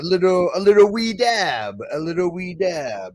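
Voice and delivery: light put-on British accent, rising. Chatting to his stream, mock British accent. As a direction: play at being British with a wee dab. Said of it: "I didn't know you speak British".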